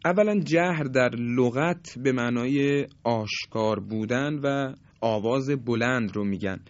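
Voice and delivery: usual speaking voice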